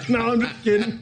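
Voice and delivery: high-pitched, laughing retraction; giggling through the words. Real, not acted. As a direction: laugh off your own tease. Laughing off own teasing joke.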